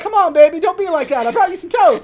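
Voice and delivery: loud, high hammed-up sweet talk; mock lizard wooing. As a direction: croon it big and silly to the girlfriend lizard. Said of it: "He's like".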